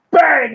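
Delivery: sudden high, loud bang; explosive mock gun blast; imitated gunshot. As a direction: shout it like a gun going off. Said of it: "You hear a gun go off".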